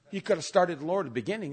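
ordinary talk

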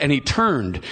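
ordinary delivery

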